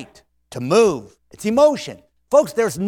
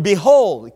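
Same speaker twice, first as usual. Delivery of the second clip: "behold" said louder with a falling sweep; extra oomph; 5 dB louder than usual. Shown as a demonstration. Preacher showing the congregation how to say behold. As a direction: give "behold" a push of feeling. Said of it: "give it a little extra oomph".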